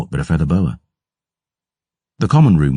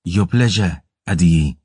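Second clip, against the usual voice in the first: put-on gentle Sudhayami accent; 2 semitones higher than usual. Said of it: "she asked in a gentle Sudhayami accent".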